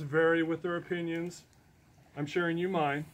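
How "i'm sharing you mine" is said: ordinary delivery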